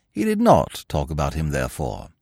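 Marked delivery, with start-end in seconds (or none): none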